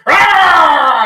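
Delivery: high, loud mock battle cry; rallying shout. Podcaster mimics the diner crowd cheering, a loud high mock battle cry. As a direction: let out a Braveheart-style roar.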